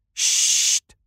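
unvoiced shush. Little Bear hushes the others, whispered. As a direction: hush them gently. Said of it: "zegt Kleintje Beer".

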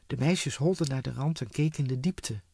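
plain reading voice

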